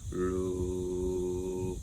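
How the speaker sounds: deepest, lowest sung note; extremely deep bass voice. Shown as a demonstration. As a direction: sing it as low as you possibly can. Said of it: "you're gonna sing very low".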